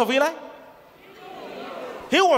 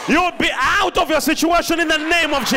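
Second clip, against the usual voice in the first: louder, higher preaching declaration; full-force preaching; 5 semitones higher, 5 dB louder than usual. Acted. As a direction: proclaim it over the congregation.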